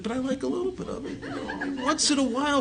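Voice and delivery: chuckling through a guilty admission; laughing confession. Real, not acted. Speaker confessing to the meeting that he likes sin, laughing.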